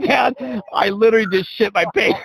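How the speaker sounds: high-pitched, cracking up mid-sentence; laughing retelling. Retold or imitated. Father imitating his son's confession, high and laughing.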